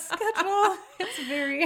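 high-pitched, through laughter; amused, voice rising into laughter; laughing. Real, not acted. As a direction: break into a laugh while talking. Teasing about Abby's changing schedule.

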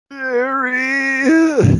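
very high, panicked yelp of the name; freaked-out Ron. Retold or imitated. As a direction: shriek it in terror at spiders. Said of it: "so freaked out".

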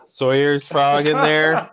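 slow put-on voice; a hint of Sawyer, slowed down. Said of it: "It's my Sawyer impression".

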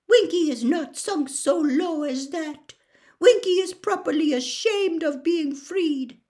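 high squeaky elf voice, offended; Winky the indignant house elf. Acted. Winky defends herself to Hermione, indignant squeak. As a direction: squeak it with wounded pride.

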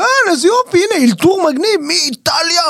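high, giddy self-congratulation; delighted inner voice. Retold or imitated. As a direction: gush at yourself, thrilled with the gig. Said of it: "ואני אומר".

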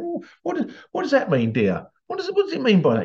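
prim wife. Retold or imitated. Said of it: "The wife went".

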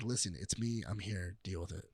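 low-key brush-off. Retold or imitated. Quoting recast film character's brush-off.

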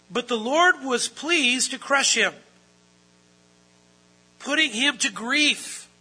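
ringing out proof from scripture; forceful preaching. Real, not acted. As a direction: proclaim the verse with rising force.

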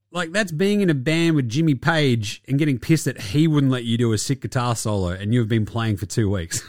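ordinary talk, nothing marked

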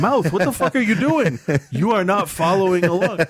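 high, exasperated mock telling-off; scolding own mouth. To her own mouth after a rude reply, high exasperated scolding. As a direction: snap at your own mouth in disbelief. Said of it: "And I'm like".

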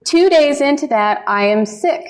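usual speaking voice

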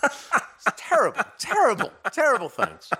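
high, slow, drawn-out mock dismay; milk the word terrible like a melodrama; hammed-up horror. Mock outrage at cat story.

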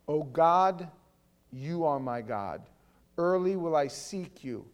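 forceful psalm reading in measured phrases; declaimed scripture. Real, not acted. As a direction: proclaim each phrase to God with weight. Reads psalm aloud with force.

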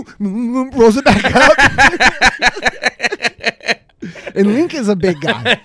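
talking through laughter; laughing while telling how big the friend was. Real, not acted. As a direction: giggle the words out.

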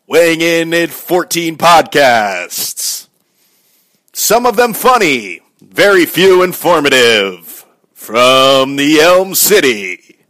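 loud, slow, drawn-out fight intro; boxing ring announcer. Acted. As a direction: announce the challenger to the arena. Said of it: "I sound like a good boxing announcer".

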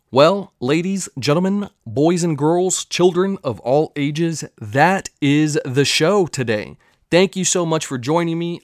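high, drawn-out showman's announcement; announcer sign-off. Acted.

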